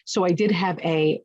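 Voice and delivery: normal talking voice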